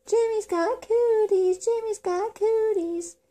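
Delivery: high, slow playground chant; yelled taunt. A narrator's reading. Girl chasing boy on playground, high yelled taunt. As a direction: yell it as a high, drawn-out teasing chant. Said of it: "she yelled".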